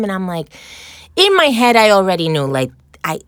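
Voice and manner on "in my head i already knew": loud and high, "in my head" bursts out; excited rush as it dawns; excited realization. Real, not acted. Telling hosts how she knew, louder excited rush.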